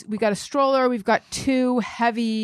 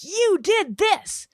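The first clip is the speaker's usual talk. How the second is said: red-faced dad; 10 semitones higher than usual. Retold or imitated. Dad confronting a stranger, high and furious. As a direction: confront someone the way dad did. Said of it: "red-faced and just going up".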